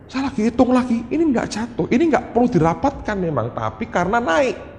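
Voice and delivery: very high-pitched, insistent amazement; excited announcement. Retold or imitated. Teacher telling mother her child passes, excited announcement.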